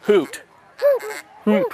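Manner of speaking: hoot into duck call. Shown as a demonstration. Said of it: "I like to say hoot".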